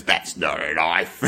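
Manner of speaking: low, slow, soft Crocodile Dundee; laconic outback drawl. Pretend. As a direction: drawl it deep and unimpressed. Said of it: "Mister Hogan ... he goes".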